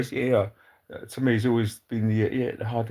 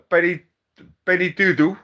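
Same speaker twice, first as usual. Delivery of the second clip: higher, louder hype on the name; excited call-out; 7 semitones higher, 6 dB louder than usual. Real, not acted. Calling out a viewer's name, excited and loud.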